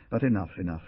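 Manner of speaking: ordinary delivery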